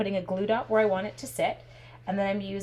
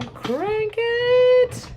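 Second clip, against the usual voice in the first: squeal; 8 dB louder than usual. Real, not acted. Cranking the die-cut machine, excited.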